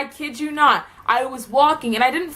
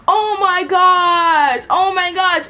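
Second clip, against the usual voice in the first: mock class uproar; 8 semitones higher, 5 dB louder, much slower than usual.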